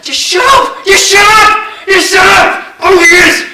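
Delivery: high, loud furious scream; screaming shut up. Acted. Gunman screaming at the hostages, furious scream. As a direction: scream them down. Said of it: "I said".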